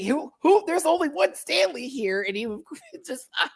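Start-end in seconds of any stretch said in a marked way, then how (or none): 0.0-2.2 high-pitched imitated protest; high mock outrage; mock indignation. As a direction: insist in a high, hammed-up voice. Quoting Michael defending Stanley.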